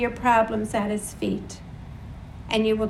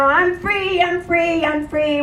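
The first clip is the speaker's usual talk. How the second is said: high, loud, joyful I'm free; excited calves; 6 semitones higher, 4 dB louder than usual. Retold or imitated. Preacher voicing calves released from the stall, high and joyful. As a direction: shout it like a calf let out. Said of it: "you know".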